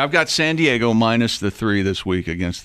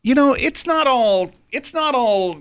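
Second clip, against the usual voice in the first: mock defensive; 10 semitones higher than usual. Defensive outburst to coworkers.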